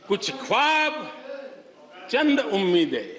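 measured ghazal recital with long pauses; poem recitation. Acted. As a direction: recite the couplets line by line to an audience.

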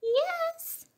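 tiny, very high, soft squeak; muffled mouse. A narrator's reading. Mouse answers from under the bed, tiny muffled voice. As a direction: answer small and high from hiding. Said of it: "came a muffled voice".

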